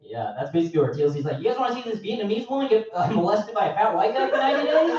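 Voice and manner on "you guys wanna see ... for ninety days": high barker pitch; hammed-up network pitchman; carnival barker. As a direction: sell it like a sideshow host.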